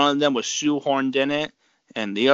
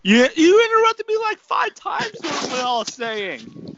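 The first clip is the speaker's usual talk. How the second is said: indignant. Real, not acted. Indignant retort to being interrupted.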